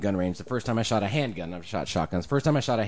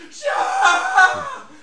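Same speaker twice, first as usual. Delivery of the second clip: very high, loud hammed-up scream; 7 dB louder than usual. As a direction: scream like a wounded soldier in a skit. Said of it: "He's screaming".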